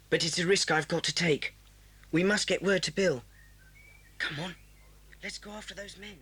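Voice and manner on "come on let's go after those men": very soft, secretive prompt; hushed urging. Acted. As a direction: urge a friend on under your breath. Children sneaking after men.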